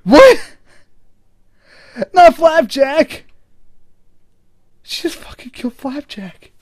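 high, drawn-out shocked outcry; shocked dismay. Real, not acted. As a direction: cry out in disbelief. Dismay at losing a character.

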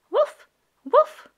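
high, loud puppy woof; puppy bark. Acted. Voicing the puppy Biscuit barking.

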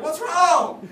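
imitated loud, high-pitched concern; alarmed question. Other person finding him crying, mock-alarmed shout. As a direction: call it out high like a startled onlooker.

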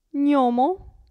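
Gnomo with a palatal ny sound. Shown as a demonstration. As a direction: say Gnomo as nyomo. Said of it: "si pronuncia ni". (Gnomo con gn palatale)

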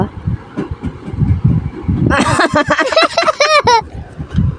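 laughing fit; long high laugh. Real, not acted. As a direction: giggle helplessly. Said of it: "may tumawa ba". (mahabang tawa)

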